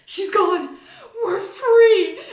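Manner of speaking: loud, high, laughing cheer. Retold or imitated. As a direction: celebrate like you've been freed. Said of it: "we all cheered".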